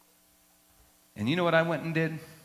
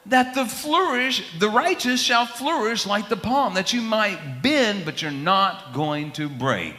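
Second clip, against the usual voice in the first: preaching; 6 semitones higher than usual. Acted. Preaching scripture in skit.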